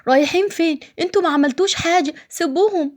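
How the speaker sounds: put-on high child's voice begging; a little boy crying out to let his father go; pleading child. Second child begs police. (طفل بيتوسل بصوت عالي)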